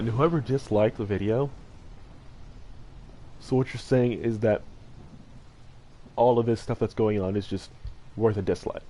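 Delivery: ordinary talk, nothing marked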